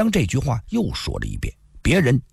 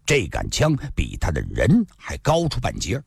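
ordinary delivery

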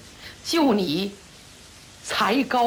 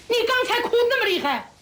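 high-pitched, rushed challenge; baffled protest; 13 semitones higher, faster than usual. Acted. Disciple challenging his senior brother over the tears, baffled protest. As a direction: protest in a much higher, quicker voice.

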